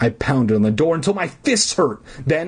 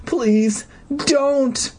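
tiny choked plea; 13 semitones higher than usual. Acted. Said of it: "in a tiny choked voice".

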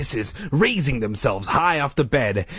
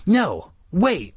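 higher, urgent call; 8 semitones higher than usual. A narrator's reading. Friend stopping Colin at the door, calling out. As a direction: call after someone to stop. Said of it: "I called back to him".

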